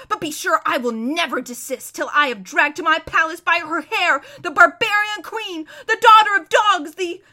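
high, loud tirade swelling at the end; shrieking rant. Acted. Prince vowing to drag off the queen, shrieking. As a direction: rave at full voice. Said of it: "shrieked Rabidash".

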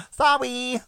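put-on high, babyish sorry. Shown as a demonstration. Co-host mocks her own way of saying sorry, babyish. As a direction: squeak it like a small child. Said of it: "probably 'cause I say words like".

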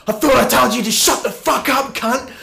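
high, loud imitation of an angry man; aggressive yelling. Stranger answering the sales pitch, retold aggressive yell. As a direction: yell it as a furious stranger would. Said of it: "he was just yelling at me".

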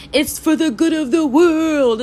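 high, slow mocking voice; mock lofty claim. Mocking them, in a lofty put-on voice. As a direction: put on a pompous do-gooder tone.

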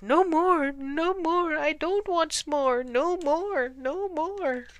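high, wailing frightened bear; high-pitched, hammed-up terror; terrified cry. A narrator's reading. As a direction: wail it in panicked pleading. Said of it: "The terrified bear cried out".

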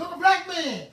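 loud scornful jibe; mocking call. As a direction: jeer it out at them.

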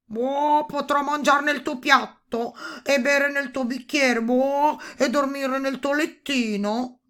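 high, loud, croaking toad; insistent toad. A narrator's reading. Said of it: "incalzò ancora il rospo".

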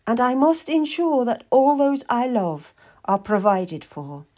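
put-on high old lady's voice, dropping at the end; Lady Maria. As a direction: speak high, as a determined noblewoman. Lady Maria speaks of bequest.

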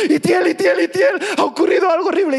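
high, loud, hammed-up frantic wail; mock tearful panic. Husband phoning the pastor about disaster, imitated frantic wailing. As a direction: blurt it out like a desperate caller. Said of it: "me llamó el hombre llorando".

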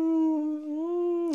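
a high squeaky "No"; imitating a stunned fan; mock disbelief. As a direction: squeal the no as if it can't be true. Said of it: "It's like".

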